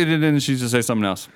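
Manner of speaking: ordinary delivery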